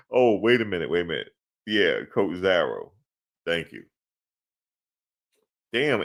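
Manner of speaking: ordinary talk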